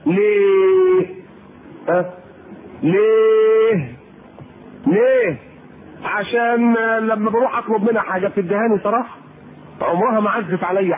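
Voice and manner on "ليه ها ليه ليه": drawn-out why. Acted. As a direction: drag out ليه, challenging. Preacher pressing the question. (ليه ممدودة بصوت عالٍ)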